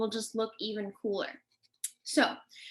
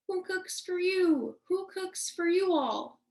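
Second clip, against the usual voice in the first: high, slow hooting owl call; barred owl call; 7 semitones higher, slower than usual. Shown as a demonstration. Teacher showing children a barred owl's call, hooted high and slow. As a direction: hoot the words slowly, high, falling on the last. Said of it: "Can you guys say that with me?".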